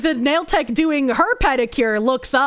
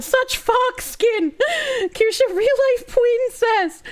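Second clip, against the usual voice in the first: high, slow, hammed-up gushing voice; mock swooning reader; 11 semitones higher, slower than usual. Reading viewers' chat aloud, mock gushing. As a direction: gush it out like a starstruck fan.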